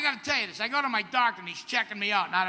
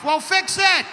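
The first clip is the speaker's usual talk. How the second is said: much higher and louder, barked order; loud demand; 9 semitones higher, 8 dB louder, slower than usual. Pretend. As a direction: shout it like an impatient patient. Mock patient demanding fix.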